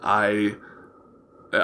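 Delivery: ordinary talk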